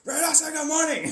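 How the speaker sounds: high, loud shouted order; mock-scolding high shout; nagging parent. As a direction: yell it like a parent prompting a child. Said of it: "like you got a kid".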